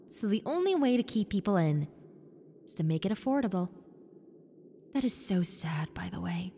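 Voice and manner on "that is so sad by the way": breathy whisper, leaning in to share a secret; whispered pity for the cheap rent; whispered aside. Acted. Ghost confides aside to listener.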